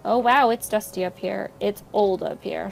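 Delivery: normal talking voice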